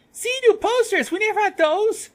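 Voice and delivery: very high, loud outburst; excited squeal. Acted. Student pointing at the bulletin board, excited squeal. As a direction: squeal with delight over a discovery. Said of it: "let out an excited gasp".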